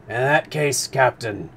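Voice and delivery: first take in the wrong character. Acted. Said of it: "Nope, it's Conseil".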